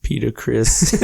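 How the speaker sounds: ordinary delivery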